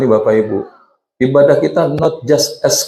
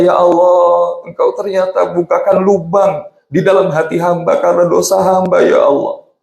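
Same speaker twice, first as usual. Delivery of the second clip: higher, pleading prayer with a mild crying edge; tearful prayer; 7 semitones higher than usual. Retold or imitated. Preacher acting out a sinner weeping to God, higher and pleading. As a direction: beg God for forgiveness, half in tears. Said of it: "terus nangis karena dosa kita".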